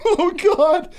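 high, tearful mock wail; imitating crying. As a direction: act out breaking down over the dog. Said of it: "And I'm like".

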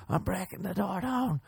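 high, thin, soft squeak; squeaky high voice. Retold or imitated. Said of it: "I'm like".

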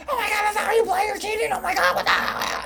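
very high wailing mock tantrum; hammed-up shrieky kid meltdown; kid losing it. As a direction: imitate a screaming child accusing others. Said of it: "he lost his fucking shit".

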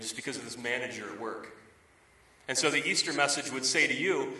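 ordinary talk

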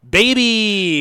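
high, loud, drawn-out character yell; exaggerated catchphrase shout. Co-host introducing himself with his catchphrase, exaggerated shout. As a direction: belt out the catchphrase, pitch falling. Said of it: "you're kinda doing, like, a character".

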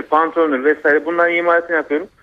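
ordinary delivery

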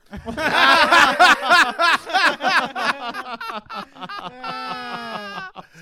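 loud high roaring laugh; helpless laughter. Real, not acted. Teller cracking up at Mister Rogers' question, roaring laughter. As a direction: burst out laughing uncontrollably. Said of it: "I was laughing so hard".

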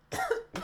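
toux brusque et violente; quinte de toux. Real, not acted. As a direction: tousser fort comme en s'étouffant. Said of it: "pardon, je m'étouffe". (s'étouffe en toussant)

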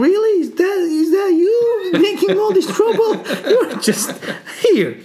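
very high, incredulous mockery through laughter; mocking disbelief. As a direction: imitate a king laughing in disbelief. King mocks small general, laughing.

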